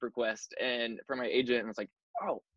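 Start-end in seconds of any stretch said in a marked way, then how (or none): 2.2-2.4 high, breathless disbelief; stunned excitement. Retold or imitated. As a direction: react in starstruck shock. Reacting to self-tape request.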